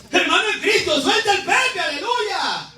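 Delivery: high, impassioned pulpit exhortation; fervent preaching. Real, not acted. Preacher urging a brother to drop the pacifier, fervent preaching. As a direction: cry it out to the congregation.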